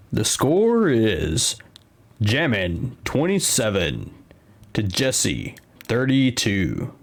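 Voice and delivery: slow, drawn-out announcer delivery; points voice. Pretend. Streamer reading the score to co-host Lee, points voice. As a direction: stretch out each phrase like a showy score announcement. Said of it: "I've gotta do my points voice".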